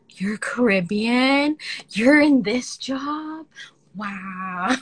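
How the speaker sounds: slow, amazed disbelief; students' surprise. Retold or imitated. Students in her office, slow and amazed. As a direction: drag it out in wonder. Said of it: "they're like".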